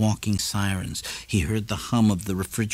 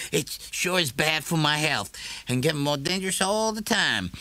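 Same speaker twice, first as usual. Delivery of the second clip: higher, amused neighbour voice; Morrison's voice; 7 semitones higher than usual. A narrator's reading. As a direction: joke about the danger to the shopkeeper.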